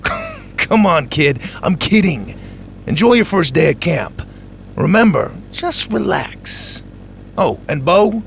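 high, bright teasing voice; playful camp janitor. Acted. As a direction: joke then reassure the kid. Kirby reassures, teasing.

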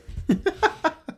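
high giggly nervous laugh. Real, not acted. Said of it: "it's that laugh that does it".

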